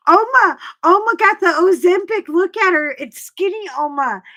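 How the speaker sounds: high, loud mock fan shout; playful mock yell; teasing shout. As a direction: tease the husband with the nickname. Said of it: "I was like".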